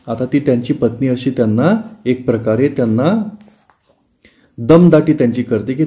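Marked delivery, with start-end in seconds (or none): none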